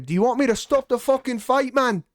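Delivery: high, hammed-up British corner voice; bad British accent. Quoting Leon's coach mid-fight, in a bad British accent. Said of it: "I do a horrible Leon accent".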